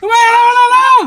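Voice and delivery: slow, very high shrieking cry for mother; fake panic scream. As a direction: scream it out like you're in mortal danger. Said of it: "எதுக்கு கத்துற?". (போலியான பயத்தில் உச்சக் குரலில் அலறல்)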